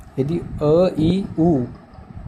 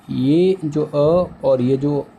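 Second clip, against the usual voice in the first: very slow, drawn out; much slower than usual. Shown as a demonstration. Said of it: "teacher stretches explanation".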